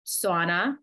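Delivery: real Jersey accent slipping out. Real, not acted. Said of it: "That was my Jersey accent coming out".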